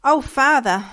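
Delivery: mildly acted distressed appeal; distressed boy. A narrator's reading. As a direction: call out as an upset boy, lightly played. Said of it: "bowed his head upon the table and sobbed".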